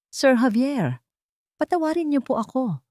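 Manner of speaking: a touch of sobbing, pleading for mercy; sobbing plea. Acted. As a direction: plead for forgiveness through a mild sob. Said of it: "Humikbi siya".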